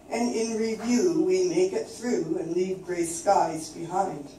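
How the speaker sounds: ordinary delivery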